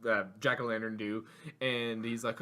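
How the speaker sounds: ordinary talk, nothing marked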